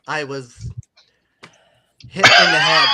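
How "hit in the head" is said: loud, emphatic shout. Real, not acted. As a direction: call it out loud.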